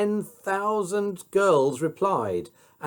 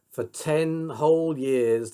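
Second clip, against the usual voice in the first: plain reading voice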